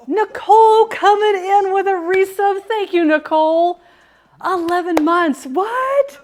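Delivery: high, loud, slow shout-out; announcer call. Real, not acted. Streamer calling out a viewer's resub, high and loud.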